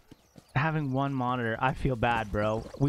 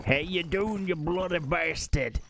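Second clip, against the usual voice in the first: higher, jokey put-on British-style accent; playful accented greeting; 4 semitones higher than usual. Streamer jokingly insulting chat, playful put-on British-style accent. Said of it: "what accents you're doing".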